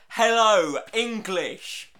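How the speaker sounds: loud, slow English tourist shouting at locals; mock English tourist yelling; mock Brit abroad. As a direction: bellow it like a clueless tourist abroad. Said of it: "You go up to them and go".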